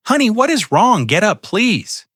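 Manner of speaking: high, urgent pleading; mother begging her kneeling daughter to get up; alarmed. A narrator's reading. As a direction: rush to her, frightened.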